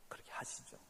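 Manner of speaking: soft obedient whisper; whispered agreement. Acted. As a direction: whisper it meekly. Acting agreeable congregant. (속삭이듯 순순히 동의하며)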